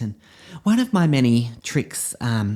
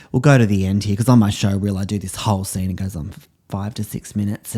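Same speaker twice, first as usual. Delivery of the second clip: ordinary talk, nothing marked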